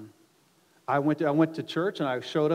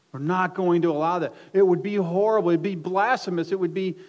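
loud, raised, horrified protest; emphatic refusal; 4 semitones higher, 8 dB louder than usual. Acted. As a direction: refuse it forcefully, as an apostle appalled. Acting out apostles refusing worship.

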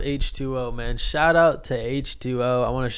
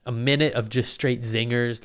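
usual speaking voice

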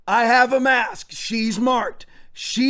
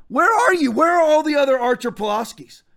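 calling out; 5 semitones higher than usual. Real, not acted. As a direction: call them out, demanding. Calling out to other pastors.

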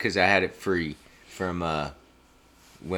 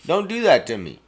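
high, loud whiny plea; loud protest; 10 semitones higher, 7 dB louder than usual. Real, not acted. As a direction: beg him loudly to stop.

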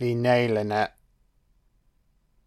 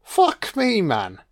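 shocked exclamation; 7 semitones higher, slower than usual. Real, not acted. Reacting to the scene.

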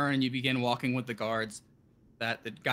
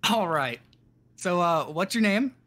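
possessed clerk; 5 semitones higher, 6 dB louder than usual. Acted. Possessed clerk asks again.

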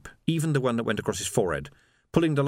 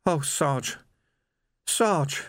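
higher, stricken appeal; tearful distress; 6 semitones higher, much slower than usual. A narrator's reading. Shaken young constable to his sergeant, tearful appeal. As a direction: appeal helplessly, pitch climbing. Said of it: "Tears were running down".

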